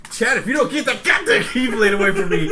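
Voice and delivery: mock scolding. Real, not acted. Host warning Chad during the sign-off, mock scolding. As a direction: snap at Chad in jest.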